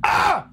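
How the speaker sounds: a high shrieked "Ah!", loud and short; sudden shriek. Acted. Telling a wild story, a loud shrieked "Ah!". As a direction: shriek it out like a startled gasp.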